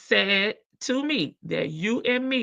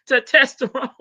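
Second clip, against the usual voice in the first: laughing; 7 semitones higher, 6 dB louder than usual. Real, not acted. Repeats it laughing.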